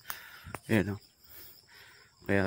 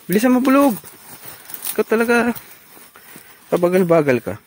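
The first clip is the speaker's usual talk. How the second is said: scolding shout; 11 semitones higher, 7 dB louder than usual. Real, not acted. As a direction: hurry the lazy bull along. Scolding the slow bull.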